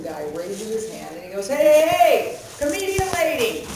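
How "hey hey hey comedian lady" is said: heckler calling out. Retold or imitated.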